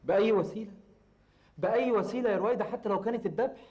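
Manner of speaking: high, heated insistence; agitated emphasis. Acted. Man warns Rowaida of rival's methods, agitated.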